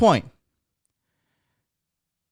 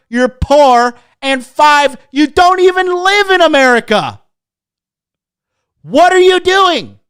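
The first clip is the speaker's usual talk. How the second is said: louder, higher, mocking tirade; exasperated rant; 5 semitones higher, 3 dB louder than usual. Real, not acted. Berating foreign fans of American politics, loud rant. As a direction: build to an incredulous shout.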